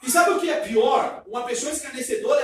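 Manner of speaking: loud, fast shouted preaching; full-force pulpit shout. Real, not acted. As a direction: shout it out to the congregation.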